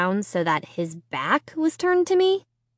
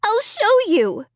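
desperate; 13 semitones higher, 3 dB louder than usual. A narrator's reading. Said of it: "I cried".

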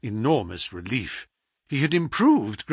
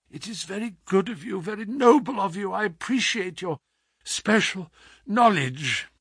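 higher, weak thanks; feeble invalid, grateful; 7 semitones higher than usual. Acted. Sick man flatters the hostile visitor, weakly grateful. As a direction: thank him humbly from the sickbed.

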